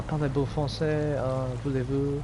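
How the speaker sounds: mock French accent. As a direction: play at sounding French. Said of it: "Sounds French".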